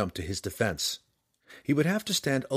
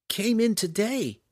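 put-on much higher, slow girl's voice; Canterbury explaining; 12 semitones higher than usual. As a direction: lift into the girl's voice, slow and careful. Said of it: "Canterbury explained".

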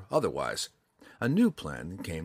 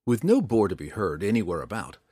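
ordinary delivery

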